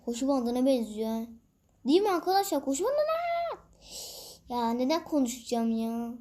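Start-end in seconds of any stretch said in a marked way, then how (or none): 1.9-3.3 much higher, teasing lilt; playful. Real, not acted. Gamer teasing viewers about a treadmill, high playful rise. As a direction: sing it up at the end.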